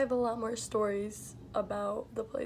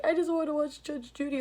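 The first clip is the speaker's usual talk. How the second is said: higher, lightly voiced old lady whine; whiny old lady; 5 semitones higher than usual. Retelling the resident's demand, lightly voiced whine. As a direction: whine it like a cranky old woman. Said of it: "she's like".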